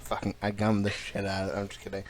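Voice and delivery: usual speaking voice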